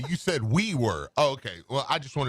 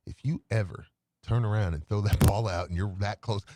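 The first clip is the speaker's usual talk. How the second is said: usual speaking voice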